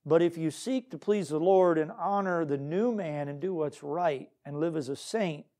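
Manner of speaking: ordinary talk, nothing marked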